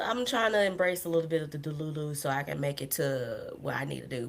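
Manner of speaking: usual speaking voice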